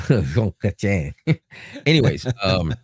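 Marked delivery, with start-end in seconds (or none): 0.1-1.1 slow, laughing, Jean said zhahn; Jean Chrétien repeated the French way, slowly; correcting back to Jean, zhahn; French pronunciation. Shown as a demonstration. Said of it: "or as you Americans might say".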